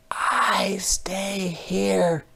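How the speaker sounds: high, soft, slow whisper; a tiny worm whispering; little worm hiding. Acted.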